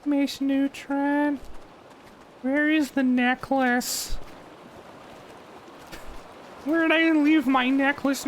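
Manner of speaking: put-on high, slow nerdy kid voice; whiny cartoon kid; Carl Wheezer voice.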